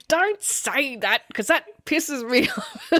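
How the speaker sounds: real giggly objection; laughing protest. Real, not acted. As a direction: draw the words out, breaking into a laugh. Laughing protest at co-host's question.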